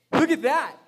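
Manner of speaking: loud, punchy preaching burst; excited urging. Real, not acted. Pastor urging church to read it that way, loud and emphatic. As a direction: punch it out to make them look. Urging congregation after the boom.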